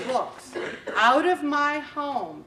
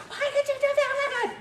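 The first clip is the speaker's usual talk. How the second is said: mock scream; 14 semitones higher, faster than usual. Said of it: "I started screaming".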